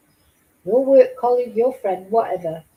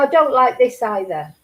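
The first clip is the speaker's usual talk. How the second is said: ordinary talk, nothing marked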